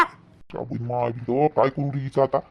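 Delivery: low, hushed grumble to himself; despairing aside. Acted. Husband wondering what to do with his wife, quietly exasperated. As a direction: sink into a soft, low aside.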